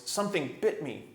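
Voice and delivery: usual speaking voice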